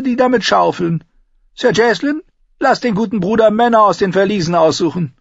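high, sardonic Tyrion; mocking dwarf voice. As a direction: taunt the knight with a sly grin. Tyrion mocks the knight.

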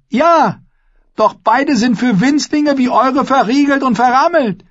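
high, loud, cheeky taunt; bold jeering call. A narrator's reading. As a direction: tease the bride's family with a grin. Alex jeers back.

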